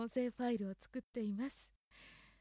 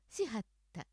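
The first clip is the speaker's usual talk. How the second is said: 京都アクセントのしはった; Kyoto accent. Shown as a demonstration. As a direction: say しはった the Kyoto way, dropping down. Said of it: "京都アクセント、".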